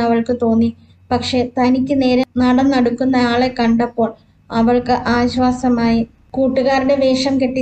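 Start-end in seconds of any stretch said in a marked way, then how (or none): none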